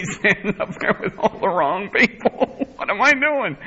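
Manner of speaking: high-pitched laughing incredulity at himself. Real, not acted. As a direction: break up laughing as you say it. Laughs picturing himself embarrassed on podium.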